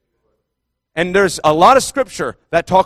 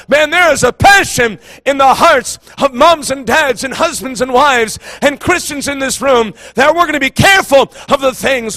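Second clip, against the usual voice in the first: raised, driving pulpit voice; impassioned preaching; 5 semitones higher than usual. Real, not acted. Preacher urging the congregation to be careful, impassioned preaching. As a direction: preach it hard, pitched up and pressing on.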